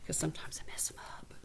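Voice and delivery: soft whispered aside. Real, not acted. Teacher confessing to viewers, whispered. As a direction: let them in on a little secret.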